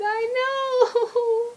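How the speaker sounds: high, wailing tearful squeal; hammed-up tearful whine; mock crying. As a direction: wail it like overjoyed sobbing, played for laughs. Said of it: "a lady saw me crying".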